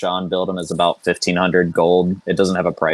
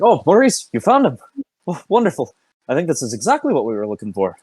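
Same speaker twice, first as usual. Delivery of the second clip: high, delighted character voice; gushing delight; 8 semitones higher than usual. Acted. Felonius gushing to Maurice over the pot, high delighted voice. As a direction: gush over the find in a high bright voice. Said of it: "he turns and he's like".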